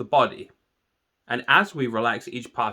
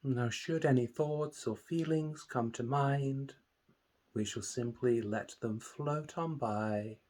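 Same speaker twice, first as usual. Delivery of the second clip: guided meditation; 2 semitones lower, 10 dB quieter than usual. Acted. Meditation teacher leading listeners through a body scan, calm and soothing. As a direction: calmly guide listeners into relaxation, unhurried and hushed.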